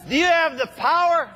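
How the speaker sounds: high, loud call to the crowd; rallying shout. Real, not acted. Speaker firing up chiropractors in the hall, with a rallying shout. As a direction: fire up the room with the question. Calling out to the audience.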